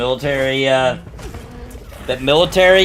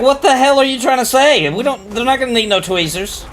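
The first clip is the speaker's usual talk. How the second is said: indignant retort; 10 semitones higher, 3 dB louder than usual. Retold or imitated. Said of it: "I was like".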